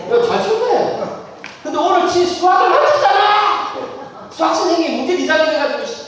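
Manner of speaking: lightly voiced son griping about the math teacher; grumbling teenage son. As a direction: sulk and grumble like an annoyed boy.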